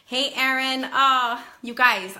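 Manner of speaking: bright, loud happy hello; excited greeting. Real, not acted. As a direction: greet a dear friend with delight. Delighted greeting of a friend.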